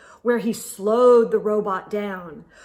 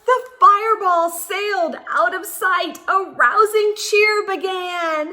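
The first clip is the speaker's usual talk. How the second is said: higher, louder cheering read; triumphant; 9 semitones higher, 5 dB louder than usual. Acted. Reader cheering Randy at the end, triumphant. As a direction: ring out the finale.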